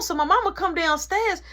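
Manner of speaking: usual speaking voice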